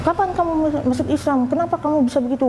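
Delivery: high-pitched imitation of a scolding mother; retold scolding. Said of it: "saya dimarahin terus".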